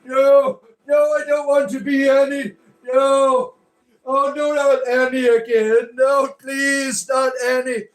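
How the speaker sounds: high, loud, hammed-up wailing with drawn-out vowels; wailing mock demon. Demon begs Christians not to become Annie, mock wailing. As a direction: plead and howl like a cartoon demon being banished. Plays demon being cast out.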